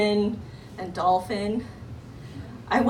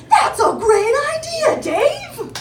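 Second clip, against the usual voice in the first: much higher, louder, mock-gushing agreement; sycophantic yes-men; 8 dB louder, slower than usual. Bored executives gushing over Dave's idea, high mock enthusiasm. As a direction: fawn over the boss's idea. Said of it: "bored other people were like".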